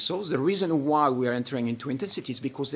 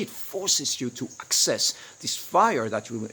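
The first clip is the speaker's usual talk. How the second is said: ordinary talk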